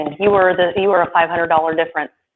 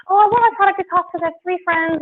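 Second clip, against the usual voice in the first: mocking caller; 10 semitones higher than usual. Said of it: "I mean".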